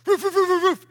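loud high barking imitation; dog barking. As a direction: bark like a big dog chasing.

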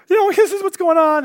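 high, loud frantic phone call; mock-frantic sister-in-law; imitated panic. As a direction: blurt it out in alarm, acting panicked. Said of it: "freaking out".

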